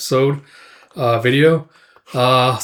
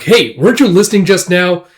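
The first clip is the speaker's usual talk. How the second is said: higher, louder reproach; acted scolding, raised in pitch and volume; indignant scolding; 6 semitones higher, 6 dB louder than usual. Casca scolds Guts for not listening, indignant.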